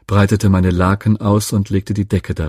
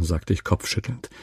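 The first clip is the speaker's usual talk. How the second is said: ordinary delivery, nothing marked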